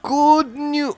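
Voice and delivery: high attempt, abandoned midway; faltering go at a put-on voice; failed character voice. Shown as a demonstration. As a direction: start the drawn-out catchphrase and give up. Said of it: "no, I've lost it".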